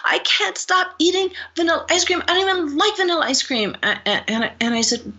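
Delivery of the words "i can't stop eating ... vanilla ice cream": panicked. Retold or imitated. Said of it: "And she's just in this panic".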